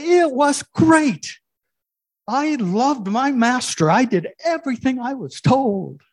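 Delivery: an overjoyed loyal dog; eager dog voice. Acted. Dog answers God eagerly.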